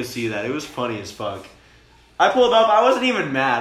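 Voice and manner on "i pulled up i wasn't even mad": much higher and louder, bursting with glee; can barely hold in the laugh; real giddy amusement, pitched up and loud; excited, laughing. Real, not acted. Recalls laughing at friend.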